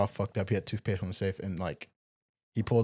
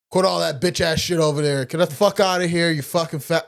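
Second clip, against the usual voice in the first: loud, higher angry yell; yelling boss chewing out; 7 semitones higher, 8 dB louder than usual. Retold or imitated. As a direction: bark it across the yard like Rob. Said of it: "He's like".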